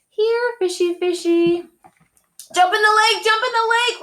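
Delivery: high loud urgent yell. A narrator's reading. Robbie yelling to Freddie to jump in the lake, urgent. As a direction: shout the order as bees close in. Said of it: "Robbie yelled".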